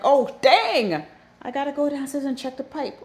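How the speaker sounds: high, loud startled cry; oh no, the pipe is still running; sudden alarmed outburst. Retold or imitated. Remembering pipe left running.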